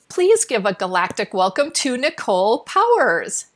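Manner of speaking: loud, emphatic show-host announcement; rousing guest introduction. Real, not acted. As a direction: announce the guest with a big flourish on the name.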